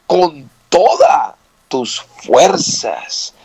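very slow, stretched "todas"; stress on TODAS. Shown as a demonstration. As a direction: hold "todas", pause, then draw out "fuerzas". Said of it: "el Señor aquí pide todo".

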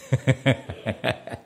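short, soft run of laughter; amused chuckle. Real, not acted. Host teasing a colleague still chewing, amused chuckle. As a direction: chuckle quietly at your own teasing.

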